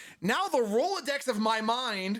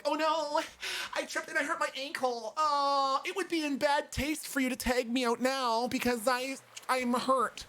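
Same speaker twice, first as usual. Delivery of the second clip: put-on high, pleading whiny girl voice; whiny feigned hurt; 5 semitones higher than usual. Girl begging not to be tagged, mock whining. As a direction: whine as if faking an injury. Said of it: "I'm expecting her to pull the whole".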